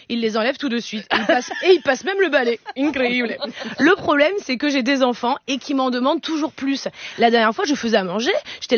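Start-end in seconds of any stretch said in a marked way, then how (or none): none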